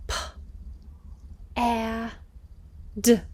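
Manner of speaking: p, a, d with pauses; segmented pad. Shown as a demonstration. Teacher segmenting pad with the class. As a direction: sound out each letter. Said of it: "try this one with me".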